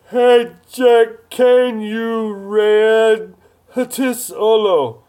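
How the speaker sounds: high, loud, drawn-out read-out; exaggerated slow yell; mock shouting. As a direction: bellow it slowly, word by word. Reads out a viewer's teasing message.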